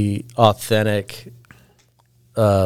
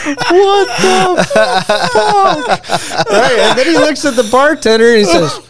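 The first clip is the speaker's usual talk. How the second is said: laughing; 3 dB louder, slower than usual. Real, not acted. Cracking up retelling the punchline.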